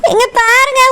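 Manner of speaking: usual speaking voice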